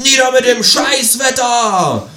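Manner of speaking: slow, drawn-out shouted protest; loud shout. Acted. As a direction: bellow it like a lone demonstrator. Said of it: "rufe ich laut und recke die Faust". (lauter, gedehnter Protestruf)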